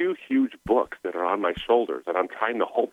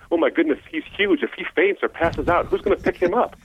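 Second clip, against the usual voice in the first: high, hammed-up panicked woman's voice; mock worried wife; 10 semitones higher than usual. Imitating his wife fretting at his crying in the parking lot, high and panicky. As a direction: squeak it out like his frightened wife. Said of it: "and she's like".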